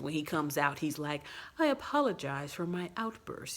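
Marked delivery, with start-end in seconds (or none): 1.6-3.6 lightly imitated affected apology; a touch of stilted, artificial politeness; weird affect. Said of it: "has this really weird affect".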